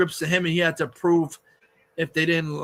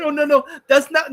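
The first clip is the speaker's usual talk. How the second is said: higher-pitched mock protest; hammed-up objection; mocking denial; 7 semitones higher, 3 dB louder than usual. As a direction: imitate deniers hurriedly objecting. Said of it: "they try to be like".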